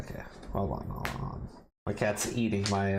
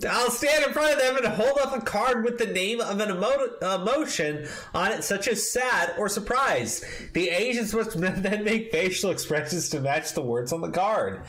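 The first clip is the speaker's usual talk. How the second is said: high, raised reading voice; mock pompous reading; 9 semitones higher than usual. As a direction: read it like a smug manifesto. Reads article quotes in a put-on voice.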